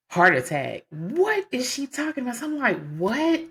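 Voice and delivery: high stunned "What?"; reeling from the news; shocked disbelief. Retold or imitated. Told husband has heart attack.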